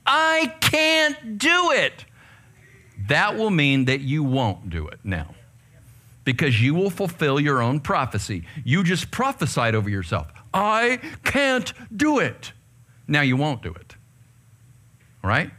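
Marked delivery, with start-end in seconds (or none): 0.1-2.1 high, slow, whining I can't; mock a defeated kid giving up; whiny defeatist. Preacher mocking a kid who gives up, whiny and high. 10.5-12.5 high, slow, whining I can't; mock a defeated kid giving up; whiny defeatist. Preacher mocking a kid who gives up, whiny and high.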